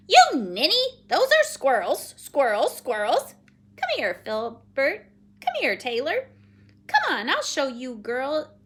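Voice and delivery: loud, bossy, squawky repeats; scolding Penelope. Acted. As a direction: scold loudly, then order them over. Figure of Penelope.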